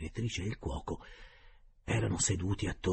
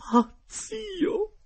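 much higher, loud shrieking cry; exclaiming lady; 9 dB louder than usual. A narrator's reading. Geltrude crying out to her uncle's spirit, high loud shriek. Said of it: "esclamò la signora Geltrude".

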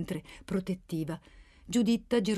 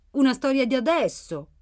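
put-on hopeful young listeners, higher and louder; domanda speranzosa; 4 semitones higher, 5 dB louder than usual. A narrator's reading. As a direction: ask it with eager hope, lifted pitch. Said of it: "sperarono i più giovani". (domanda piena di speranza, voce più alta)

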